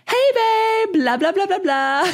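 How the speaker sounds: high, lilting sing-song greeting; imitating a bright sing-song voice message; sing-songy. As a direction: bounce it up high like a happy friend. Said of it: "Her voice was, like, sing-songy".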